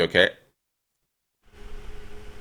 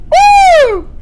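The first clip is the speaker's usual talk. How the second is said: very high, loud whoop; victory whoop; 13 dB louder than usual. Real, not acted. Streamer celebrating a landing, ecstatic whoop. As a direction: whoop at the top of your range.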